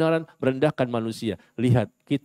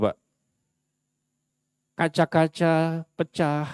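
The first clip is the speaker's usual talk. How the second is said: ordinary delivery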